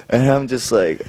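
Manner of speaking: usual speaking voice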